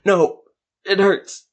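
high, loud whimpered no; dying sorcerer whining at his killer; whining plea. Acted. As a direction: beg as the blade twists. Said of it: "Stesko whined".

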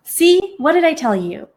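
high, loud told-you-so; excited. Acted. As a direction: crow it out triumphantly. Friend gloating on the phone.

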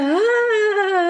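high imitated yelp of pain, half-hearted; mock pained yelp. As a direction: squeak out a retold cry of pain. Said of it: "I just like".

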